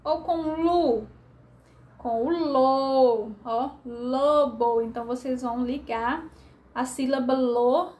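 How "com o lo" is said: put-on "lo" stretched slowly and clearly for children; the syllable "lo" said slowly for a reading lesson; drawn-out syllable. Shown as a demonstration. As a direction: drag out "lo" like a teacher sounding it. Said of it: "Com lo?".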